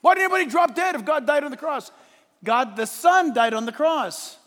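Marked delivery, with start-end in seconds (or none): 0.0-1.9 mock skeptical letter-writer, louder and rushing; mocking objector. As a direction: rattle off the critic's objections fast and loud. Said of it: "they always write me and they say".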